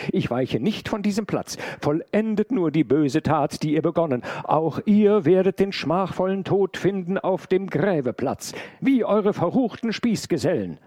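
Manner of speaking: high, loud, unyielding; defiant warning. A narrator's reading. Maid threatens the armed intruder with execution, loud defiance.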